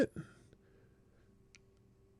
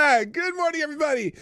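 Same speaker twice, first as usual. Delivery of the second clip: much higher, bright sing-song hello; cheery greeting; 14 semitones higher than usual. Real, not acted. Host welcoming morning chat viewers, high and cheery.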